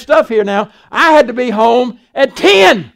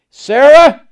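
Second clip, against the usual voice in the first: calling out; 2 semitones higher, 7 dB louder than usual. Retold or imitated. Abraham calls wife to pack.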